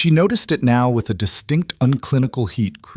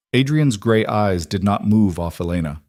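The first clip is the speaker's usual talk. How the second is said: ordinary delivery, nothing marked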